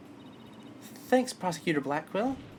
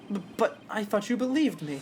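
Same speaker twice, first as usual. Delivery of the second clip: ordinary delivery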